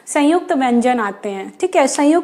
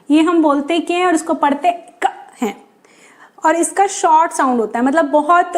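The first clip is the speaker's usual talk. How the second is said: ordinary delivery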